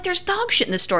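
high-pitched insistence without extra volume; incredulous self-quote; urgent retold report. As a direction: try to get her attention, pitched high. Retelling urgent report to friend.

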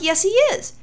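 mock-indignant yes he is; insistent retort. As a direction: snap back that you're right. Insisting against her partner.

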